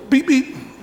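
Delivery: high beeping; light high mock beeps; fob beeps. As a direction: chirp it like a key fob. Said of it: "Jesus goes".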